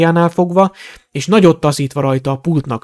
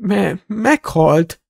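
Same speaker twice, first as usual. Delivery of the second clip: frightened stutter, slightly raised voice; stammering; 5 semitones higher, much slower than usual. A narrator's reading. Shopkeeper denying the dark lord's return, stammering. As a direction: trip over the words, dragging the last. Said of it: "dadogott Borgin".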